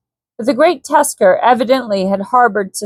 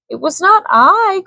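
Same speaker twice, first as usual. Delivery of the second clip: higher, pleading denial; frightened cry; 10 semitones higher than usual. A narrator's reading. Captive Swede cries out to Korak, frightened and high. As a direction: cry out in fear of the ape man. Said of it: "cried Malbin".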